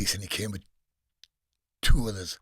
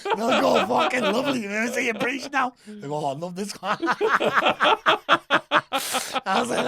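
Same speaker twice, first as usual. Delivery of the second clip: retold mocking quote, much higher and louder, laughing through it; 13 semitones higher, 6 dB louder than usual. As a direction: laugh through the soldiers' teasing reply. Mocking police reply, laughing.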